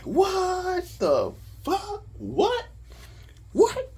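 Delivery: high, drawn-out squeal of amazement; ecstatic disbelief. Retold or imitated. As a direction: re-enact stunned joy, pitch shooting way up. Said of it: "I'm ecstatic".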